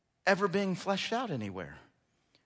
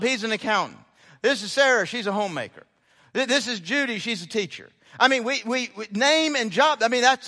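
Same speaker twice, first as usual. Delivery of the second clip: louder, slightly higher sermon delivery; lively preaching; 4 semitones higher, 6 dB louder than usual. Acted. Pastor illustrating job identity to the congregation, lively preaching. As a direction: paint the introductions with energy.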